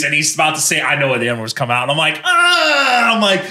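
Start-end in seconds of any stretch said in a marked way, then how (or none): 2.2-3.1 very high, drawn-out mock scream; alarmed yell. Tourist interrupting an impending slur, high mock-alarmed yell. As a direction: cut him off with a comic yell.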